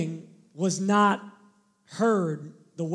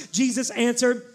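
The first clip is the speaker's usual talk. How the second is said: ordinary delivery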